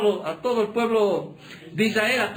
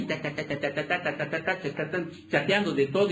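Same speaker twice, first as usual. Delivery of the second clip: mock typing chatter; 6 semitones lower, 5 dB quieter than usual. Mimicking phone typing chatter.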